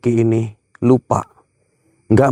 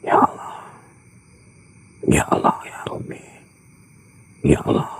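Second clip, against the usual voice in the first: hushed breathy prayer under the breath; whispered prayer; 9 semitones lower, 7 dB quieter than usual. Real, not acted. Ghost hunter praying to himself, whispering. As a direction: murmur the prayer so the spirit won't hear. Scared host prays at moving object.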